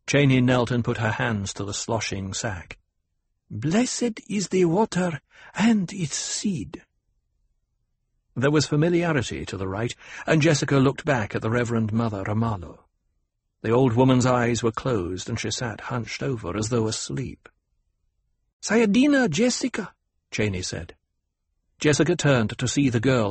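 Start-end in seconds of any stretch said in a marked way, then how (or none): 3.5-6.8 put-on high, slow girlish question; a girl priestess asking solemnly; young girl voice. A narrator's reading. 18.6-19.9 put-on a girl priestess asking solemnly; young girl voice. A narrator's reading.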